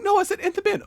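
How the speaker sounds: high, loud mock correction; exasperated correction. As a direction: imitate arguing over a misheard order. Acted quarrel over misheard order.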